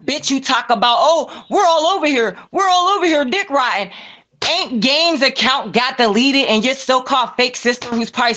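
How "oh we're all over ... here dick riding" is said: higher mock whiny voice quoting them; sneering higher impression of a hanger-on; mocking imitation. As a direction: mimic them as a simpering fan. Mocking quote of someone.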